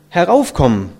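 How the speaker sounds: loud repeated order from mother; insistent call. A narrator's reading. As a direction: call it out firmly. Mother repeats her summons.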